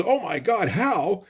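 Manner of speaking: high, startled question; shocked gasp. Retold or imitated. Hacken shocked hearing the zombies got in. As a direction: react in disbelief. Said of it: "Hacken goes".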